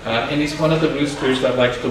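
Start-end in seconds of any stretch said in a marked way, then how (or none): none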